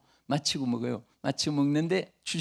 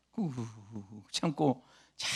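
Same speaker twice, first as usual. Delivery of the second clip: muted endurance; 7 semitones lower, 9 dB quieter than usual. Acted. Enduring quietly.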